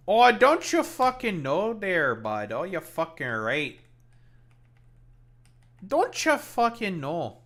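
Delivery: high, slow, swooping Canadian caricature; extra Canadian accent. Acted. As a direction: stretch out a hammed-up Canadian accent. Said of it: "Goddamn Canadian".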